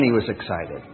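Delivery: ordinary talk, nothing marked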